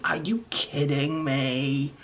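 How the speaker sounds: low, slow incredulous drag; drawn-out disbelief. Real, not acted. Vlogger stunned by her crayon art, low slow disbelief. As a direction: let the disbelief sink in slowly.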